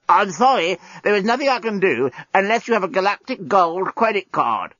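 a robot being throttled, squeezing it out; simulated strangled voice. A narrator's reading. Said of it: "in a simulated, strangled voice".

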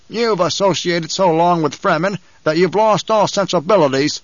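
a mild rasp of wounded pride. A narrator's reading. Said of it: "the banker rasped".

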